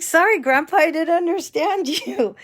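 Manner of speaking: high, loud apology through laughter; laughing apology. Retold or imitated. Granddaughter apologises to angry grandpa, laughing. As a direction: protest while cracking up.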